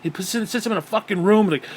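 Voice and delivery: ordinary talk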